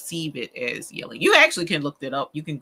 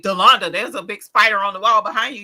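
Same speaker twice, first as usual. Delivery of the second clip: loud, raised mock alarm; urgent warning; 4 semitones higher, 8 dB louder than usual. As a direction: act out calling a warning across the room. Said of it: "that's different".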